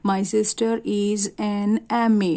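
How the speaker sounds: very slow, careful answer; slow classroom demonstration of the answer; slow model sentence. Shown as a demonstration. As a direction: read the corrected sentence out slowly. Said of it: "ये क्या हो जाएगा".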